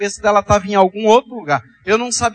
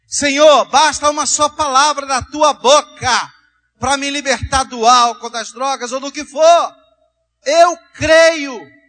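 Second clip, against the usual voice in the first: raised, impassioned preaching voice; fervent prayer; 4 semitones higher than usual. Acted. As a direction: declare it to God with conviction, pitched high. Leads a fervent declaration of faith.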